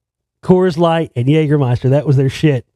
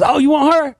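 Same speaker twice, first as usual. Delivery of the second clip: imitating Don; 10 semitones higher than usual. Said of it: "And Don goes".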